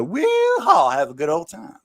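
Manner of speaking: high "Woo-haw" in a mock hillbilly accent; hillbilly whoop. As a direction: whoop it up like a country boy. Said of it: "And then you just go".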